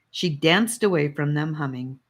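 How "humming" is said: usual speaking voice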